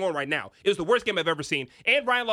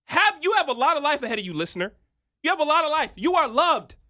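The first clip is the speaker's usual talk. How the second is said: mock-sincere pep talk; 7 semitones higher, 5 dB louder than usual. Mock-sincere address to listener.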